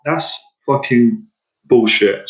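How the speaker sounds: ordinary talk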